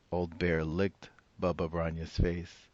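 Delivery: ordinary delivery, nothing marked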